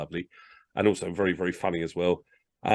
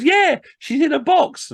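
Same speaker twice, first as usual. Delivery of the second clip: chirpy stagehand; 8 dB louder, slower than usual. Retold or imitated. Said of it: "He goes".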